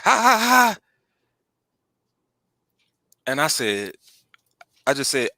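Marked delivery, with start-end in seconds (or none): 0.0-0.8 high-pitched imitated laugh; put-on "ha ha ha" laugh; mock laughter. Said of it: "they start laughing like".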